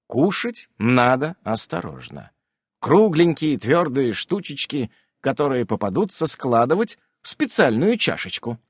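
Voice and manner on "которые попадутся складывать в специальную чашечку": ordinary delivery, nothing marked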